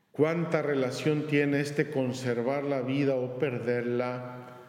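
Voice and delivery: ordinary delivery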